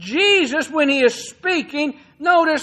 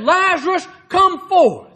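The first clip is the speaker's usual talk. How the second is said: higher-pitched commanding call; loud cry; 4 semitones higher than usual. Retold or imitated. Preacher repeats Jesus' call over death to Lazarus, loud cry. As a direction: summon him with a raised voice. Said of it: "he cried out with a loud voice".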